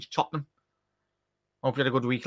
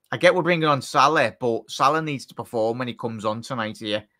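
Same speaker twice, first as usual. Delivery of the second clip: ordinary delivery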